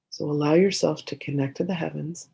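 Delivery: usual speaking voice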